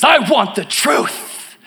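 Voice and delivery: Tom Cruise impression. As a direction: push the lawyer's demand, drawn out. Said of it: "And Tom Cruise says".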